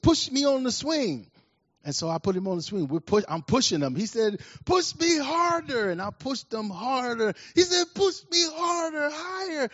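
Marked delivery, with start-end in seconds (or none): 0.0-1.3 put-on high-pitched little boy begging for a push; high, eager kid voice; young boy's request. Young boy asking on a swing. 4.6-5.9 put-on very high, slow, drawn-out kid demand; high, stretched-out child's plea; insistent young boy. Boy urging more on the swing. 7.9-9.7 put-on very high-pitched, urgent kid voice; high, stretched childlike demand; excited young boy. Boy urging even more on swing.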